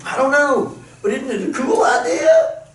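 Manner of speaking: excited churchgoer. Retold or imitated.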